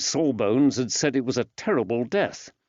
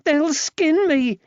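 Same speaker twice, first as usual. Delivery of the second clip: put-on high, slow, frightened boy's plea; a scared boy sure he'll be hurt; terrified child; 6 dB louder, slower than usual. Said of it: "the terrified boy".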